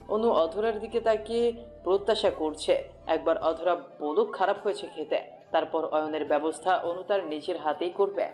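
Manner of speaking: ordinary talk, nothing marked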